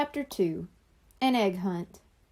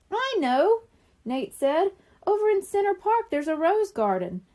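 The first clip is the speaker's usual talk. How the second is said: eager answer; 8 semitones higher, 4 dB louder than usual. A narrator's reading. Boy has the answer.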